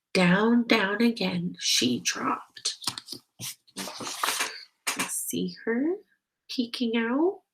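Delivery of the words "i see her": usual speaking voice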